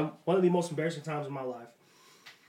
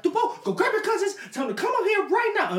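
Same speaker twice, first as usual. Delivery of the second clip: high-pitched mock orders; imitating mom; 3 dB louder than usual. Mom sends her son for his cousins, high bossy imitation. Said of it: "And they're like".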